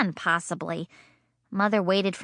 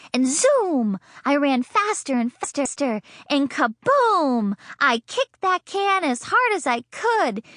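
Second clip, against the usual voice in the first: excited; 6 semitones higher, 3 dB louder than usual. A narrator's reading. Excited retelling of the kick.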